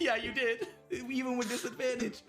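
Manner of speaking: high, amused agreement through laughter; laughing. Real, not acted. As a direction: agree while cracking up. Game master laughing at player's win.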